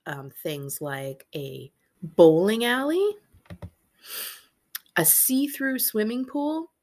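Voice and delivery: ordinary delivery, nothing marked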